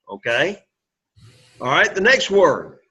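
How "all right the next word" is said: ordinary talk, nothing marked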